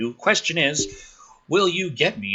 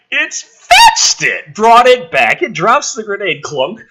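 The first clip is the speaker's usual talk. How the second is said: louder, higher with slow build; excited; 7 semitones higher, 10 dB louder than usual. Acted. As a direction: play up the absurd reveal. Dog returns grenade, building excitement.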